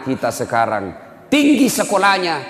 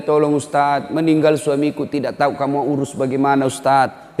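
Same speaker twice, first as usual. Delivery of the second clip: ordinary delivery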